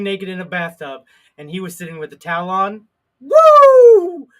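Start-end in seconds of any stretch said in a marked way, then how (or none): 3.2-4.3 high whooping woo; mock woo. Excited whoop after bathtub story.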